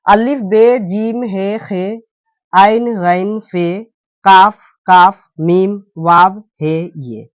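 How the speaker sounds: slow, spaced letter-by-letter recital; slow recitation. Shown as a demonstration. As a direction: recite each letter name slowly for students to memorize. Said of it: "कौन कौन सा".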